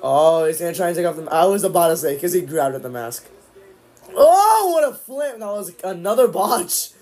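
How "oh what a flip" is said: excited. Real, not acted. Live reaction to a move.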